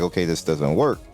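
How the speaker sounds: usual speaking voice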